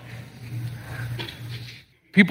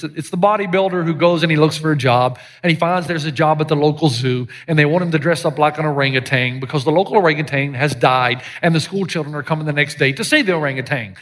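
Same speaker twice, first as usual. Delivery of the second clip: usual speaking voice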